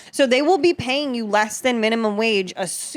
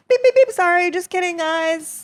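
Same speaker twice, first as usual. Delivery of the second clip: high-pitched flustered backpedal, easing off at the end; retold squeaky panic; mock panic; 6 semitones higher than usual. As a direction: play up frantically undoing a mistake. Panicked undoing of huge tip.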